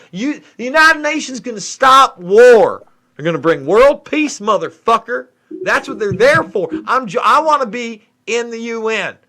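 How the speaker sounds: hammed-up South Dakota accent; mock South Dakota kid voice. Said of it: "weirdest South Dakota accent I've ever heard".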